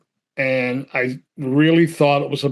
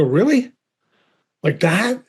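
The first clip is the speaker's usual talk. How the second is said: incredulous; 6 semitones higher than usual. Retold or imitated. Reacting to his death scene.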